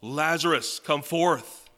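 loud, commanding call; Jesus commanding Lazarus at the grave, loud and powerful. Acted. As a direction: call the dead man out of the tomb. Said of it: "in that powerful voice".

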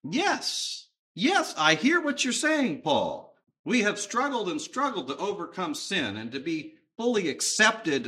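high, eager agreement; rising to a high, loud finish; relieved Gentile believer. Acted. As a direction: act out a believer delighted to be let off the struggle. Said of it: "God-fearers who are saying to themselves".

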